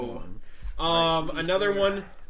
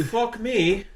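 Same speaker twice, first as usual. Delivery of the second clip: startled guard; 8 semitones higher, 4 dB louder than usual. Acted. Dim guard sees specter.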